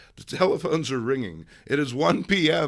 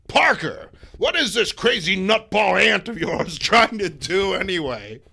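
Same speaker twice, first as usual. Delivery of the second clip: loud, higher barking editor; blustering boss; 5 semitones higher, 8 dB louder than usual. Acted. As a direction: yell at the cub photographer. Jameson yelling at Petey.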